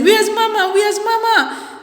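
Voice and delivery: high, loud repeated question; child calling for mother. Retold or imitated. Child asking the household for her mother, high insistent call.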